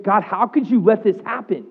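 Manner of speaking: high, loud, pained protest; reenacting crying out to God; anguished cry to God. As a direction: relive the raw complaint after losing custody. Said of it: "And I remember saying".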